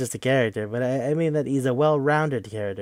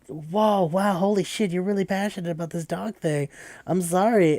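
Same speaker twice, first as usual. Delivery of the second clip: higher-pitched, startled sympathy; taken aback; 7 semitones higher than usual. Retold or imitated. Player to biker crying over his dog, taken aback. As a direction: back off apologetically, voice up.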